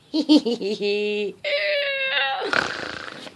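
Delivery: long, very high giggly squeal; overjoyed squeal. Real, not acted. Grandma packing for granddaughter, overjoyed squeal. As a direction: squeal with real, tearful glee.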